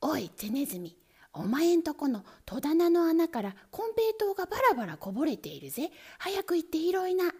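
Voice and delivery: quick, pushy weasel voice; fast talk. Acted. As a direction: rattle it off fast. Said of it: "早口に言いました".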